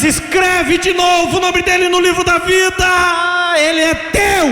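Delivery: high, fervent intercession; shouted prayer. Retold or imitated. Mother praying her son back into the book of life, shouting. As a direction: cry it out to God as a claim.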